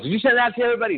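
high, teasing wife's voice; wife's mock question. Wife teasing him about sending memes, high mock voice. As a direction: pitch it up like his amused wife. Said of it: "Pam goes".

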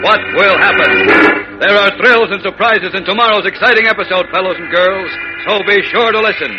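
dramatic serial sign-off, rising; old radio announcer. A narrator's reading. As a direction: hype the next episode like old radio.

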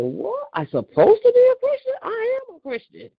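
insulted, throwing the words back; offended disbelief. Retold or imitated. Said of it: "I said".

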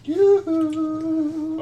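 high sing-song call; sing-song yoo-hoo. Acted. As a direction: call out cheerily in a high lilt. Player calls out playfully.

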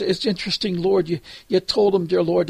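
ordinary talk, nothing marked

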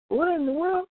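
high, baffled "What in the world?"; baffled exclaim. Retold or imitated. Radio host mocking his own shock at a commercial, high baffled. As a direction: throw it up high in disbelief. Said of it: "you're like".

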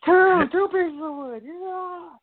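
high, slow, stretched-out holler; mock movie yelling. Host quoting the door gag, hammed-up yelling. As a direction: holler it like a hammed-up film character. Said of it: "everybody's yelling".

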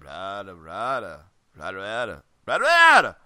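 cartoon giant's babble when girls walk in; Schnitzel at girls. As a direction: grumble low, then burst out. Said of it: "he'd be like".

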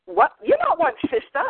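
high-pitched imitation of horrified locals; mock horrified. Locals hear her Boston accent and recoil, mock horror. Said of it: "they would just be horrified".

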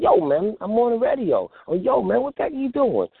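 acted phone call. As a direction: mimic someone talking on the phone for all to hear. Acted loud phone callers.

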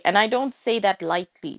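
ordinary talk, nothing marked

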